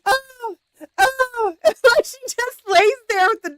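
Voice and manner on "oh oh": high-pitched wailing "oh"; mock helpless holler, pitched way up; imitated hollering. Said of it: "she's just hollering out".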